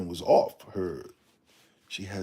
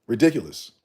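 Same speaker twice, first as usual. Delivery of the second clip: ridiculous said out louder, falling firmly; 3 semitones higher, 7 dB louder than usual. Real, not acted. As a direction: land ridiculous with force. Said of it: "It's ridiculous".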